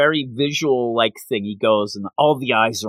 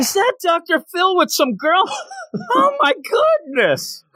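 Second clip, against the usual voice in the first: mock shock. Mock surprise in made-up scene.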